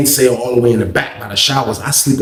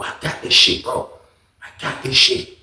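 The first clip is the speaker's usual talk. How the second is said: whisper; 6 dB quieter than usual. Retold or imitated. Friend reassuring quietly in cell.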